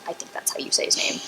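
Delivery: unvoiced whisper under the breath; whispered aside. Real, not acted. Unsure of the doctor's name, whispering an aside to the listeners. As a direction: whisper the doubt as a private aside.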